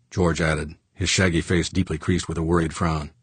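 plain reading voice

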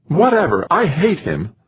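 high, petulant outburst; upset wife. A narrator's reading. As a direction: throw it back at him, upset and high-pitched. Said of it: "She was really upset".

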